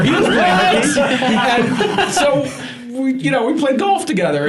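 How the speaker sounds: chuckling through the words; laughing. Real, not acted. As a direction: laugh while you tell it. Laughing while telling story.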